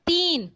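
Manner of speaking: teacher sounding out تین slowly, long ee. Shown as a demonstration. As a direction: stretch the ee in تین so it is clearly heard. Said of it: "غور سے سنیے گا کیا ہے".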